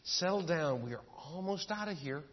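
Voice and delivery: soft, hushed father calming his son; patient dad soothing a screaming toddler, very softly. Retold or imitated. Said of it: "he said very softly".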